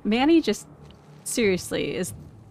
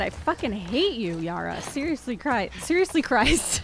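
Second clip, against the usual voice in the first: ordinary talk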